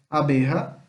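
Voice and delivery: Abeja with j as a throaty h; j in Abeja pronounced as a breathed h; Spanish jota sound. Shown as a demonstration. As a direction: say Abeja like "abeha". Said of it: "ele tem som de R".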